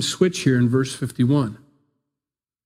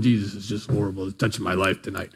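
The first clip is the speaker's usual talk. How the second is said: lower, softer, fast sour mumble; grumbling sour churchgoer; 7 semitones lower, 6 dB quieter, faster than usual. Retold or imitated. Sour listener grumbling through the same sermon, low and quick. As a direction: grumble it like someone who hates every word. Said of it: "The other person's going".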